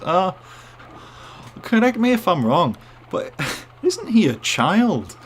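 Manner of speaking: put-on high, slow Scottish accent with a laugh; imitating a Scottish colleague. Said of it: "this Scottish guy".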